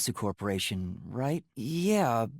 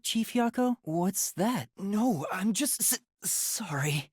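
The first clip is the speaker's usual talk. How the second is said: ordinary talk, nothing marked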